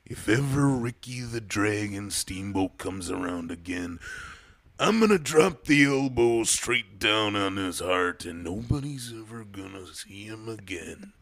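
raspy wrestling promo growl; Randy Savage impression. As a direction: hype it like a wrestler cutting a promo. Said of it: "Macho Man Randy Savage impression".

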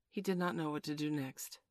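usual speaking voice

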